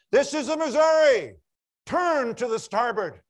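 booming captain's shout across the water; shouted command. Retold or imitated. Captain of the Missouri shouting at the light through fog, booming. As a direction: bellow the order from the ship's deck.

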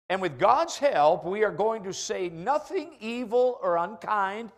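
loud, rising preacher's charge; preaching at full force. Acted. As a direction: drive the challenge home to the church.

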